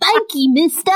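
high, chirpy street urchin; shoeshine boy. Retold or imitated. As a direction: thank him like a little orphan. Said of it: "my terrible street urchin voice".

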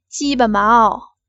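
slow loud seductive call; slow, coquettish loud call. Acted. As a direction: draw it out teasingly, loud and slow. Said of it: "尽量浪声叫着".

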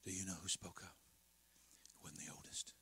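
whispered aside to the congregation; hushed. A narrator's reading. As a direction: drop to a whisper, drawing them in. Preacher hushed storytelling.

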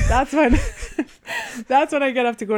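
words broken by laughter; laughing. Real, not acted.

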